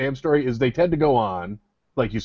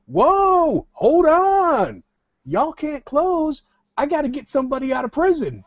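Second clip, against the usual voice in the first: high, stretched-out hollering plea; imitated protesting grandpa; 11 semitones higher, slower than usual. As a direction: play an old grandfather stopping them at the door, hammed-up. Grandfather stops bank from closing.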